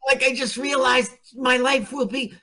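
hammy stage actor. Pretend. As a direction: ham it up like a silent film star. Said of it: "Now I'm acting".

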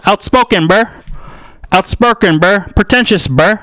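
high, emphatic bro voice; hype bro. Acted. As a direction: punch out each verdict as a bro. Bro voice comments on himself.

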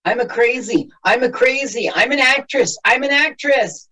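very high mocking squeak; squeaky high voice. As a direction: squeak it out like a cartoon girl. Mimicking a movie line, very high.

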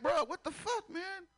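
high, disbelieving outburst; can't believe the questions; mock bafflement; incredulous. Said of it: "You like".

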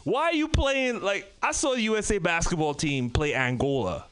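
ordinary talk

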